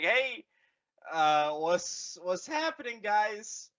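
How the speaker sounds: high, casual dopey greeting; lightly voiced bewildered rebel; mock Resistance voice. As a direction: play it like a clueless hero.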